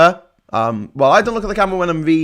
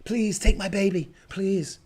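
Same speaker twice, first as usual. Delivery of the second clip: mock pleading; 6 semitones higher, 5 dB quieter, slower than usual. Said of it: "They'll be like".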